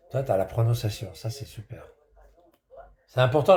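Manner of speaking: usual speaking voice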